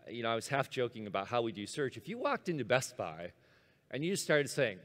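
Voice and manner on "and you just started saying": ordinary delivery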